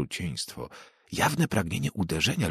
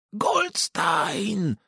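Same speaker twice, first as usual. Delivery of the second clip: much higher howled name; howled taunt; 13 semitones higher than usual. Acted. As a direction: howl it as the door slams. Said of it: "zawył chłopiec". (chłopiec wyje zza drzwi)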